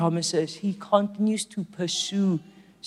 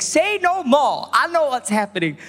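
urgent; 8 semitones higher, 3 dB louder than usual. Retold or imitated. Preacher answering a five A M call, urgent.

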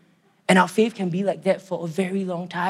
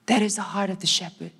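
normal talking voice

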